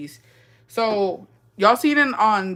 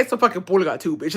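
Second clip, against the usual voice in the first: sassy retort. Retold or imitated. Imagined retort to vendor.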